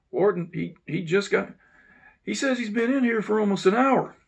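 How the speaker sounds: loud, high reply shouted upward; calling back up. Retold or imitated. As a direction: shout up so the warden hears. Yelling back up to warden.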